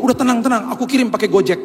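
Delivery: husband imitating his wife's breezy reassurance; mock calm wife. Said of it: "Terus dia bilang begini".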